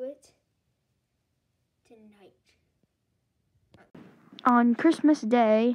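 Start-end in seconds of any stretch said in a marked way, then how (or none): 4.4-5.8 loud slow menacing declaration; dramatic villain boom. Acted. Villain reveals when, loud slow menace. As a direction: announce the doom slowly and loud.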